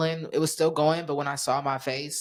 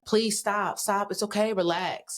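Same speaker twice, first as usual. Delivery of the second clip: high pleading yell; mild mock scream of please stop; retold screaming; 5 semitones higher, slower than usual. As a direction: beg him to stop, voice pitched up. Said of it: "And I'm screaming".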